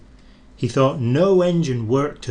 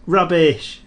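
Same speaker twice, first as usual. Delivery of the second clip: louder cross little engine; cross retort; 5 semitones higher, 5 dB louder than usual. A narrator's reading. Thomas answering the teasing engines, mildly cross. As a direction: snap back indignantly. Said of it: "said Thomas crossly".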